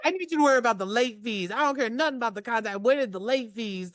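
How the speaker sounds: fast, high-pitched mock nagging; imitated scolding mom. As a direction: rattle it off like an exasperated mom. Mom scolding over fees, retold.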